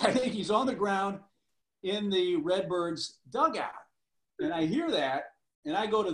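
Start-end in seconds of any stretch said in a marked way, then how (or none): 0.0-3.7 higher, amused retelling of the analyst's blurt; quoting the analyst. Retold or imitated. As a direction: replay the analyst's blurt on air, half laughing. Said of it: "He just spouts out on air".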